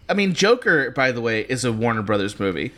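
usual speaking voice

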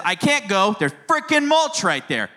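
high-pitched imitated three-year-old, "frickin'" hit hard; mock child outburst stressing "frickin'"; hard, confident stress. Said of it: "hit that word real hard and confident".

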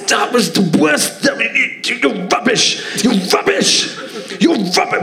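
high, furious yelling man; angry heckler shout. Retold or imitated. Angry punter yelling at comedian after a bad gig, high mock shout. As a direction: shout him down like an enraged punter. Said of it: "he shouted at me".

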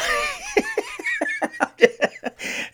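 high, wheezy giggling fit; real helpless high laughter. Real, not acted. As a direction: crack up at your own story. Cracks up at own story.

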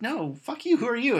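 high-pitched mock indignation; mock indignant refusal. Cops turning away Batman's request, mock indignant.

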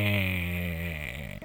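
long, low, droning mechanical noise; imitated spinning-wheel whine. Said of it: "I can just hear, like".

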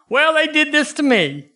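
high whiny mock complaint; high, whining mock protest; whiny complainer. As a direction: mimic a self-pitying churchgoer.

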